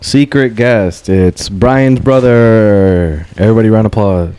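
slow, drawn-out guest introduction; mock announcer. Host introduces Brian's brother for applause, mock announcer. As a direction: draw out each word like an MC.

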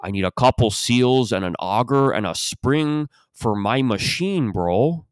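stretched Toronto "yo" and "bro", swooping up; Toronto accent. Retold or imitated. Warehouse customer asking for machine parts, mock Toronto accent. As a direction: imitate a drawling Toronto customer. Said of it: "that was every customer".

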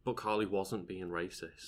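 quiet, deadpan sarcasm; softly mock-defending someone; sarcastic. Real, not acted. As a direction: say it drily, not meaning a word. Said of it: "That's me being sarcastic".

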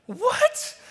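squeaked-up loud disbelieving what; stunned what. Retold or imitated. Parents stunned at the kitchen island, loud high disbelief. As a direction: blurt it out in shock.

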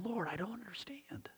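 hushed, voiceless whisper of confusion. Retold or imitated. Habakkuk confessing to God, hushed whisper. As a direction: breathe it out as a bewildered whisper. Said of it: "And he's going".

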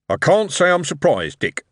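Harry, impressed boss. A narrator's reading. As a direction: speak up heartily to a young engineer.

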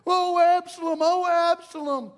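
high, loud mock lament, hammed up; mocking the king's crying, high and loud; mocking wail. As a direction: whine it like a crybaby king. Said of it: "You're over here, 'Oh".

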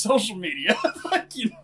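breaking into a laugh. Real, not acted. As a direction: giggle through the words. Laughs at own joke.

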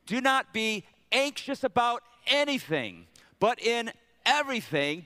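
measured call for everyone to join in; leading group recitation. Real, not acted. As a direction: lead the congregation word by word. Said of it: "Say it with me".

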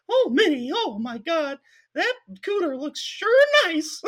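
slow, leering high falsetto, loud at the start; creepy Mickey voice. Retold or imitated. Flirting with Minnie in front of parents, squeaky mouse voice. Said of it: "look at the parents and be like".

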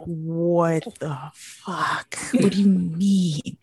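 very slow, drawn-out words; slow-burning retold incredulity; seething disbelief. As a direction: stretch each word out in disbelief. Acts out her fuming disbelief.

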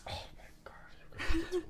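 slow, breath-only whisper of disbelief; hushed, drawn-out exasperation; exasperated whisper. Real, not acted. Exasperated at criticism of tone.

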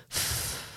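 soft dismissive French sigh. Shown as a demonstration. Podcast host shows the French sigh. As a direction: breathe it out, unimpressed. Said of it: "it's like a French, a French sigh".

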